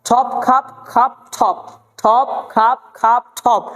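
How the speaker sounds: ঠপ, খাপ with a puff of air on each first consonant; breathy release opening ঠপ and খাপ; aspirated T and K. Shown as a demonstration. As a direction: hit ঠপ and খাপ with strongly aspirated th and kh. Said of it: "আমার সঙ্গে practice করবেন".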